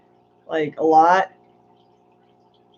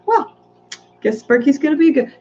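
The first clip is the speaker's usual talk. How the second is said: high mock surprise; 9 semitones higher than usual. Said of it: "I was like".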